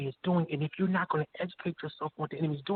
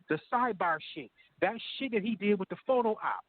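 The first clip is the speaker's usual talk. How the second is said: ordinary talk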